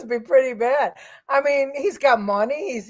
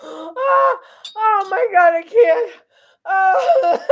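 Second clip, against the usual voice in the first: high, loud, tearful laughing; worn out from cracking up; helpless laughter; 5 semitones higher, 5 dB louder, slower than usual. Real, not acted. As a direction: gasp it out between real laughs. Recovering from fit of laughter.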